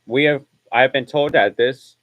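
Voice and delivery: loud urgent radio bulletin; terse radio update. Acted. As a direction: relay it like breaking news. Continues broadcast alert.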